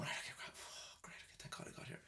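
breathless whispered relief. Retold or imitated. Man finally reaching the toilet, whispering relief.

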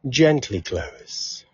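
unhurried meditation guide, long pauses between phrases; calm, measured relaxation cues with lingering silences; guided meditation. Acted. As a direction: ease them through a body scan, leaving space after each phrase.

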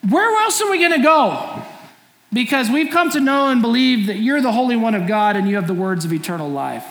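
Peter blurting out where else could we go; a disciple's earnest, exasperated loyalty; Peter's earnest plea. Retold or imitated. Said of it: "he said".

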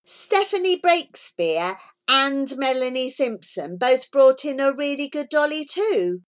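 plain reading voice

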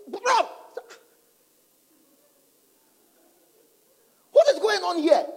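alarmed. Retold or imitated. Alarmed in the bath.

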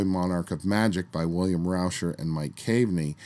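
normal talking voice

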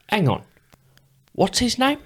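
high-pitched Cockney impression. Metal singer checking a name mid-autograph, puzzled high Cockney. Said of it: "his literal voice is".

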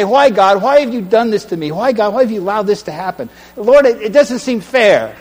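anguished cry to God. Acted. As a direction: plead with heaven, voice climbing.

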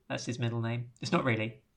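normal talking voice

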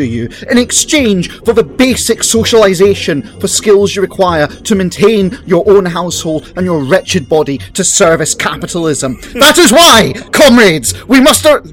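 loud, rising rallying cry to comrades; fiery revolutionary speech. Acted. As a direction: thunder it at the comrades. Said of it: "That was longer than sixty seconds".

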